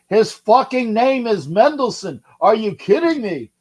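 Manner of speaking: punchy, swooping comedian cadence; Jackie Mason. Acted. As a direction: drag out the stressed words, indignant comic. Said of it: "why am I sounding like".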